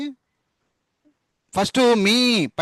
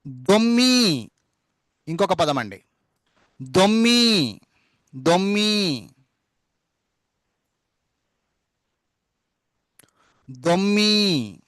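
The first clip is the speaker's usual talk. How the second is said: దొమ్మి drawn out very slowly, the double మ్మ held; slow dictation; much slower than usual. Shown as a demonstration. Teacher dictating దొమ్మి slowly to the class.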